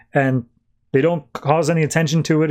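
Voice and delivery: usual speaking voice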